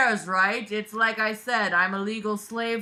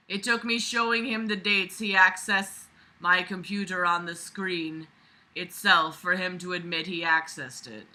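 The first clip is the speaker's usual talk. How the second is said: usual speaking voice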